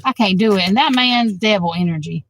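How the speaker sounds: ordinary delivery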